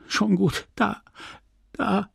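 high, failing, broken-up phrases. A narrator's reading. Dying wife answers her husband, failing voice. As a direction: let the voice give out between words. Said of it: "sagte sie mit versagender Stimme".

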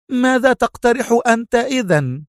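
high-pitched, heated challenge; sharp cry. A narrator's reading. Mona challenging the consul for his own proposal, crying sharply. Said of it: "صاحت في حدة".

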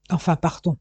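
ordinary delivery, nothing marked

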